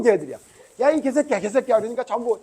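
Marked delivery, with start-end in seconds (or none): 0.8-1.8 loud high mock cursing; kids cursing. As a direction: shout the insult like rough schoolboys. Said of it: "막 이러는 거야". (아이들 흉내 낸 욕설 고함)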